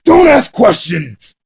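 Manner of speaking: enraged gunman screams at the captive; screamed. Acted. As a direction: scream the order in his face. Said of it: "and screamed".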